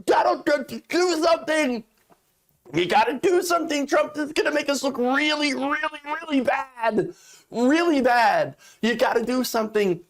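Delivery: mock Sean Hannity begging Trump to act; pleading Hannity impression. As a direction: beg him to act, whining a bit. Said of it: "Sean Hannity being like".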